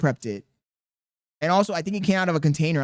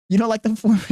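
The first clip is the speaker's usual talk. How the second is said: laughing; 4 semitones higher, 7 dB louder than usual. Real, not acted. Laughing at baby's reaction.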